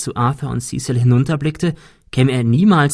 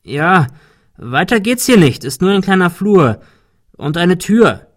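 high, loud call back; 6 semitones higher, 5 dB louder than usual. A narrator's reading. Ben answers his friends from the top, calling down. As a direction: project the answer down to friends below.

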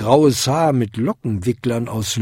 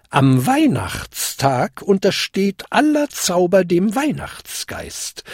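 put-on high, solemn old lady; warning; 8 semitones higher than usual. A narrator's reading. Old lady explaining magic ends before midnight, warning. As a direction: lay down the spell's limit. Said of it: "warnte sie".

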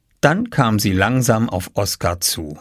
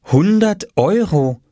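langsam, hoch vom Schild abgelesen; ablesend; 9 semitones higher, 3 dB louder, slower than usual. A narrator's reading. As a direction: lies es gedehnt und staunend ab. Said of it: "las Mama auf dem kleinen Papierschild". (gedehntes, hohes Vorlesen eines Schilds)

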